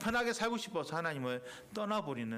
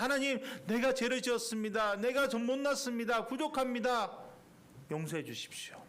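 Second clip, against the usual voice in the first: repentant prayer; 7 semitones higher, 3 dB louder than usual. Retold or imitated. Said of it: "무릎 꿇고 기도하면".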